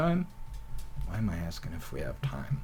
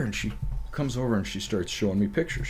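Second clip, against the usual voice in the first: normal talking voice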